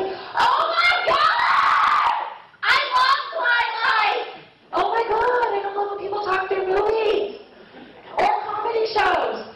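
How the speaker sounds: high-pitched mock squeal, words stretched out; hammed-up movie-theater superfan; gushing fangirl. Girl down the row gushes over Twilight preview, gushing.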